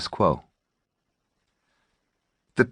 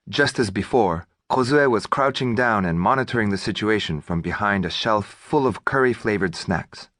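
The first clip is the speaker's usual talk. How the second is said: usual speaking voice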